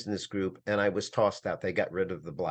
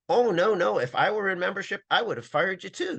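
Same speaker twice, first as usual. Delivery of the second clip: dismissive caller; 10 semitones higher, 4 dB louder than usual. Retold or imitated. Voicing the member's dismissive reply.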